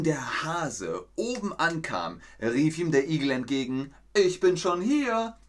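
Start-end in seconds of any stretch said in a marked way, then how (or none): 4.1-5.4 much higher, louder gloating call; smug call. Acted. Hedgehog calls to the hare, much higher and gloating.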